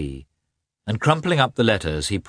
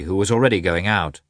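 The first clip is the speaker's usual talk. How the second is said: ordinary delivery, nothing marked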